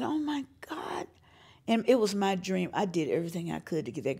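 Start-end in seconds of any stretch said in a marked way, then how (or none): 0.0-1.1 breathless awed whisper. Retold or imitated. The speaker is overwhelmed by the family and whispers in awe. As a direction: murmur it in amazement. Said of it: "And I said".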